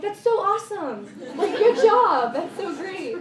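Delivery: excited mom. Retold or imitated. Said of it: "she'd be like".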